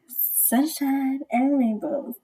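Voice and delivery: slow, drawn-out, wiggling lilt; little wiggle. Pretend. Fan gushing about the older lady, playfully drawn out. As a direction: stretch it playfully with a wobble in the tune. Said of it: "It's like a little wiggle".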